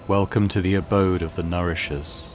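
lightly voiced monotone mutant priest.

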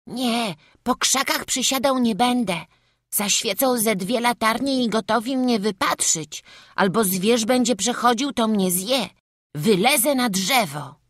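little lad daring the dark, high and bold; playful small boy. A narrator's reading. Boy hiding in the forest, high and playful.

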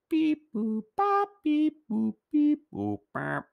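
high robot-like beeps; silly beeping voice. Pretend. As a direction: chirp the counting sounds playfully. Counting decimal moves in silly voice.

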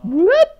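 sudden high loud whoop. Pretend. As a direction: yelp it like a game sound. Game sound effect shout.

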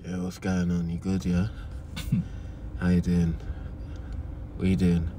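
very low, soft fake smooth voice; low put-on charmer voice on the phone; little fake voice. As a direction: go deep and quiet to charm her. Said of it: "let me hear your little fake voice".

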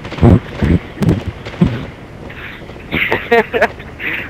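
long wheezing laugh at the joke; breathless laughing fit. Real, not acted. Laughing at a friend's crude joke over voice chat, breathless giggles. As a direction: giggle helplessly, nearly silent.

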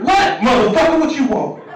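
tired coworker snapping. Retold or imitated. Coworker yelling at someone who greets him, loud irritated snap. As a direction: bark back at whoever bothers you.

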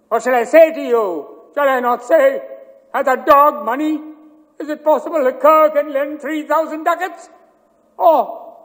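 high, loud, raging cry; furious shout. Acted. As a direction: hurl the insult back at him. Shylock's outburst at Antonio.

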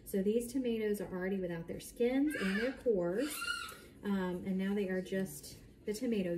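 ordinary talk

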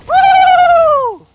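very high, long "woo"; piercing falsetto whoop; excited whoop. Real, not acted. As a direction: squeal it out loud. Thrilled by weapon effects.

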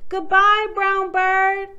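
put-on high, loud child's farewell; cheery calling out. A narrator's reading. Susie waves off the bird, calling out. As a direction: call it out to a bird above.

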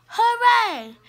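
high, loud hooray with falling end; cheering. Acted. Pupils hearing it's milk time, cheering. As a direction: cheer like a delighted class.

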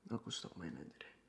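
ordinary talk, nothing marked

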